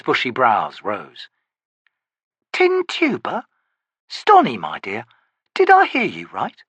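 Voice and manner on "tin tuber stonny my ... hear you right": amused, mocking man's voice; teasing rogue. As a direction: toy with her. Harlow teases again.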